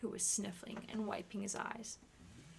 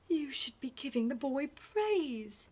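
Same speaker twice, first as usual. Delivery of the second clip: admonishing; 6 semitones higher, 6 dB louder than usual. Acted.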